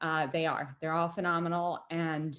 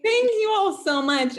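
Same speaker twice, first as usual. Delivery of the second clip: excited; 13 semitones higher, 8 dB louder than usual. Real, not acted. Winner thanks, still thrilled.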